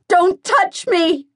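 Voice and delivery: high, loud, slow yell at the top of the lungs; full-throated scream of refusal. Acted. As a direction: fight him off with your voice. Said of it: "I yell at the top of my lungs".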